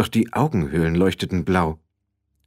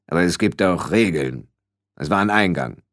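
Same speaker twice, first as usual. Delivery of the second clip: low, measured explanation; solemn, lowered voice of Death; heavy-voiced Death; 3 semitones lower than usual. A narrator's reading. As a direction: lay down rules in a weighty voice. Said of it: "Die Stimme war schwer".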